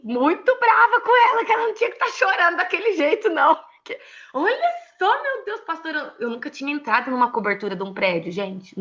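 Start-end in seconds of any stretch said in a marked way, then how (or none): 0.0-6.1 high, fast, worked-up telling; a touch of mock outrage; exasperated retelling. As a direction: relive the exasperation.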